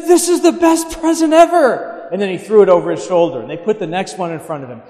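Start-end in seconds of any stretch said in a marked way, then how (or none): 0.0-2.1 put-on high, loud, gushing kid voice; excited child. Nephew opening his first Christmas present, high and gushing. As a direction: squeal it like a six-year-old tearing into gifts. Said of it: "He said".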